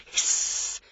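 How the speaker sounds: whispered, long sibilant ending; hissing word. A narrator's reading. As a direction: let the word hiss away softly. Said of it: "came a noise from the woods".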